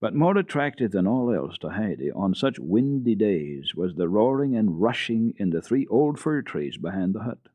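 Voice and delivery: plain reading voice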